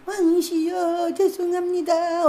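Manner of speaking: slow, high, fearful plea; shaking midwife begging the king; trembling apology. Acted. Frightened midwife apologizing before the king, slow trembling plea. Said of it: "달달달 떨면서".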